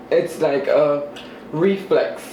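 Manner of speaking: ordinary talk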